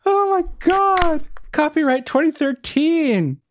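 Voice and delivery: slow, high, amazed disbelief; drawn out in astonishment. Real, not acted. Amazed at old copyright.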